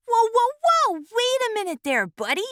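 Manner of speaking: high, loud alarmed whoa; alarmed protest. Acted. Acorn protesting to the nibbling squirrel, alarmed. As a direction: stop him fast, startled.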